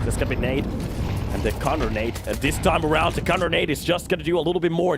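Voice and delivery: excited play-by-play calling; esports caster. Acted. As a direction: call the action fast and hyped. Live play-by-play of the round.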